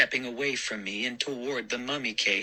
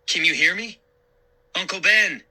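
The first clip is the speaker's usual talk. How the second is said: louder, higher urgent calling; 8 semitones higher, 5 dB louder, faster than usual. A narrator's reading. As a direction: try to rouse him. Calling unconscious uncle, louder.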